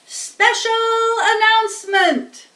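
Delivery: high, loud, slow announcer boom; pompous loudspeaker. Acted. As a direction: announce it like a self-important fair PA. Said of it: "said the loudspeaker in a pompous voice".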